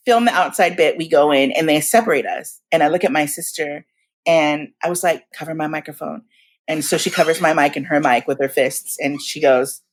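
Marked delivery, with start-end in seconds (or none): 5.3-6.2 hushed request. Retold or imitated. Secret request to sister.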